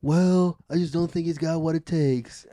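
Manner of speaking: lightly voiced Triple H sneer, dismissive; mock Triple H dismissing a rival; Triple H impression. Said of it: "I've heard him do a Triple H before".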